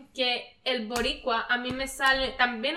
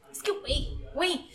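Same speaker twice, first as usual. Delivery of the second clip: mock Mexican slang, fresa-style; Mexican accent; 3 semitones higher, 5 dB quieter than usual. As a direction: drop into a Mexican accent for the quote. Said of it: "ella es mexicana y ella dice".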